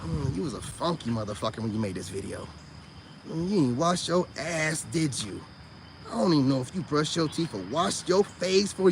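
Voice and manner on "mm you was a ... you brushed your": very low, soft contemptuous mutter; quiet low scorn; low disgusted mutter. Retold or imitated. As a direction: grumble it low at the screen. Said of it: "I'm like".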